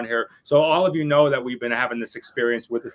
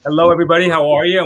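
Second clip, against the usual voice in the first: big loud welcome to the listeners; excited loud greeting; 4 semitones higher, 12 dB louder, faster than usual. Real, not acted. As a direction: call out the hello loudly and brightly. Host's loud show opening greeting.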